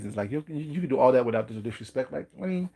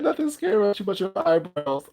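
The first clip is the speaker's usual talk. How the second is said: laughing retelling; 8 semitones higher, 4 dB louder than usual. Retold or imitated. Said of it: "he said".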